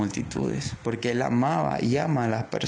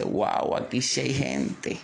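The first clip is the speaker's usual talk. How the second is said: a hint of Colombian coastal voice; lightly put-on costeño exclamation; costeño expression; slower than usual. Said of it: "Perdonen la expresión un poco colombiana o costeña".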